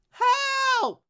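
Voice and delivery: high, sustained cry for rescue; screaming for help. Acted. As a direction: hold the word long on a high note. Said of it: "stop screaming".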